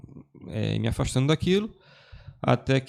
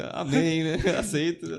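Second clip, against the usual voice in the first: puzzled amen; 4 semitones higher, slower than usual. Retold or imitated. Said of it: "com aquela interrogação assim".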